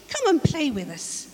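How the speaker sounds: high, cheerful invitation; playful call. Acted. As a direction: coax a friend to come play. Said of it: "called the other fish".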